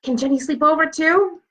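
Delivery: put-on a hopeful little girl begging mom; eager child asking. Girl asking mom about a sleepover, eager.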